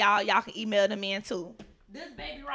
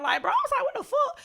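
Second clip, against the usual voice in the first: very high, squeaky laughing what the fuck; laughing disbelief; 4 dB louder than usual. Retold or imitated. Reliving being high at work, squealing through laughter. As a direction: crack up and shriek it.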